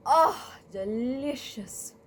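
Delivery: slow, drawn-out relish; loud sigh of pleasure, words stretched; savouring delight. Acted. Rat sneaking a spoon of rice, savouring.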